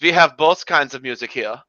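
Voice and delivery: imitating a German club doorman; hammed-up German accent, rising high on the punchline. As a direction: proudly list it in a mock German accent. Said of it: "it was straight out of the Blues Brothers".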